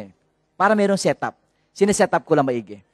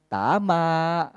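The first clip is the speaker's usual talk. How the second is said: normal talking voice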